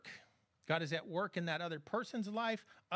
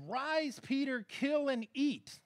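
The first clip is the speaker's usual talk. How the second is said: slow, higher and louder command; a booming divine order, drawn out; God's command; 7 semitones higher, 4 dB louder, slower than usual. Acted. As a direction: proclaim it slowly like God speaking.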